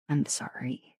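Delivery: ordinary talk, nothing marked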